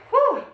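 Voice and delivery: high whoop of effort; excited whoop. Real, not acted. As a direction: let out a sharp high whoo. Instructor cheering during hard squat.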